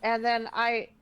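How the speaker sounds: usual speaking voice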